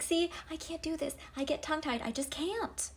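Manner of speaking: high, pleading whine, voice well up; Moses, panicking and begging to get out of it; whiny Moses. Retold or imitated. Moses pleading with God to let him off, high whine. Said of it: "And Moses is like".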